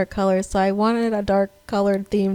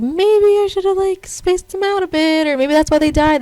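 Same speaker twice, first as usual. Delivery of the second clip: worried inner voice; 8 semitones higher, 6 dB louder than usual. Retold or imitated. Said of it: "I was like".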